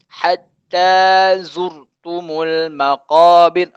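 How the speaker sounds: slow, drawn-out recitation; teacher modelling the verse for students, slow recitation; Quran recitation. Shown as a demonstration. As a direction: chant the verse slowly and evenly.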